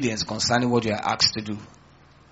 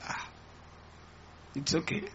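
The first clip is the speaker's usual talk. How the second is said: very soft, meek little reply; hushed mock humility; humbled acceptance; 3 semitones higher, 18 dB quieter than usual. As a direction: shrug off the insult softly, as if humbled. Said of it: "One of the ways you get humble is through fasting".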